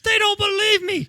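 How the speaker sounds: loud, high hammed-up complaint; mock whiny rage. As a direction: shout it like a sulking madman. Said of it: "voicing the angry captor".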